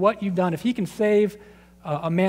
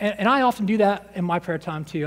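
normal talking voice

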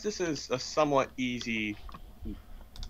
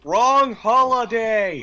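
loud joking shout; 5 semitones higher, 7 dB louder, much slower than usual. Real, not acted. Shouted joke at picture.

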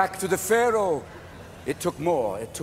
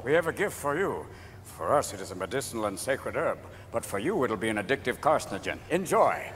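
low, quiet, solemn gift-giver; 10 semitones lower, 6 dB quieter than usual. Acted. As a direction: speak low and soft, as if bestowing something sacred. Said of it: "And the Indians go".

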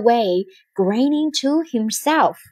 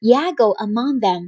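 ordinary delivery

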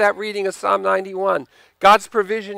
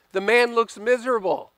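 ordinary talk

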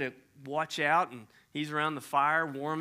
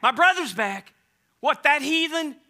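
louder, higher, scornful disbelief; indignant outburst; 10 semitones higher, 9 dB louder than usual. Acted. Older brother hearing the news, indignant loud. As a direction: spit out heathen in outrage.

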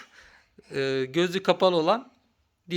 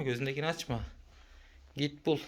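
hushed whisper; 4 semitones lower, 13 dB quieter, faster than usual. Real, not acted. Hushing so hider isn't heard.

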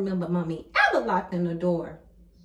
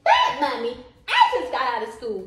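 normal talking voice

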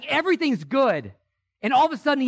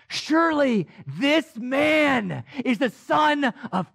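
awed proclamation; 3 semitones higher, slower than usual. Retold or imitated. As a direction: proclaim it in awe.

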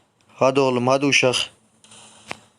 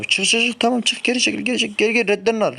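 high, rushed shouts to retreat; urgent calls; 8 semitones higher, faster than usual. Real, not acted. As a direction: yell at the team to pull back. Yelling commands at teammates. (telaşlı, tiz bağırış)

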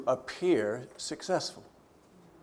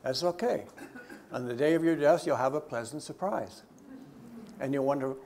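ordinary talk

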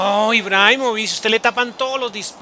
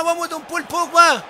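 shouted cheer, pitched up high; 8 semitones higher than usual. Real, not acted. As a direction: yell it out to cheer him on. Cheering on a player.

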